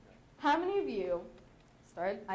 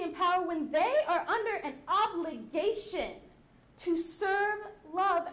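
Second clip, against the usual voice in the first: impassioned monologue; 4 semitones higher, 7 dB louder than usual. Acted. Wife scolding women for declaring war, impassioned declamation. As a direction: build to a forceful scolding.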